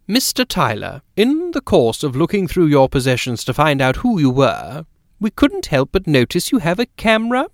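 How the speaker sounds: lady's lead-up, then lift "camera?" into a question; rising question. Acted. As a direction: pitch climbing high through to "camera?". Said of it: "Her voice rose in a question".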